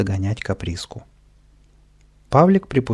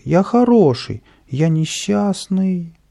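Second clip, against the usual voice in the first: whiny plea; 8 semitones higher, 3 dB louder, slower than usual. Acted. Continues whimpering plea.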